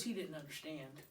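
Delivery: usual speaking voice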